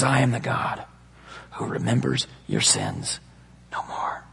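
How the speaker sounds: quiet, solemn near-whisper; hushed divine promise. Acted. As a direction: let the promise sink into a hush. Quoting God's forgiveness, hushed.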